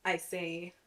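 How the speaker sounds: usual speaking voice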